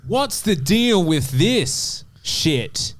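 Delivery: slow Seinfeld bit with an Australian twang. Pretend. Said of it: "Jerry Seinfeld, ... Australian twang".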